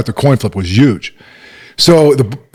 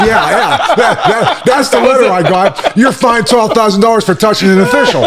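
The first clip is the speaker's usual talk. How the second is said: higher, laughing through the fine; laughing retelling; 9 semitones higher than usual. Real, not acted. Coach laughing while recalling the fine letter.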